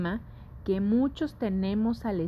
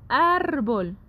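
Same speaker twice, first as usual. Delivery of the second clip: "árbol" with ÁR high and drawn out, BOL falling; stress on ÁR; 4 semitones higher, 3 dB louder than usual. Shown as a demonstration. Teacher repeats the word for listeners, stressing ÁR in "árbol". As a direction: push the first syllable of "árbol" up. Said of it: "se pronuncia con mayor fuerza".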